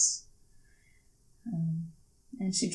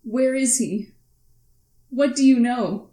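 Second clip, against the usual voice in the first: higher, louder, pressing questions; scowling demand; 4 semitones higher, 6 dB louder than usual. Acted. Daughter presses Claire about her missing father, upset and demanding. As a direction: demand answers, getting upset. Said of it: "she scowls a bit".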